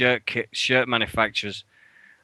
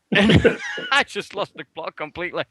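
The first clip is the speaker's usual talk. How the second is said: laughing; 6 semitones higher than usual. Real, not acted. Laughs recalling the outburst.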